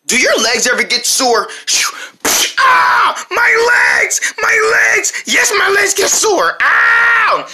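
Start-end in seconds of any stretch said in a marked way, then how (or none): 2.6-7.5 high, wailing mock agony; hammed-up pain. Sore-legged advert sufferer, high hammed-up wailing. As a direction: cry out in exaggerated pain.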